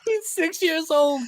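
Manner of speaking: high, drawn-out squeal through laughter; cracking up. Real, not acted. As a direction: barely get it out for laughing. Cracking up at his own story.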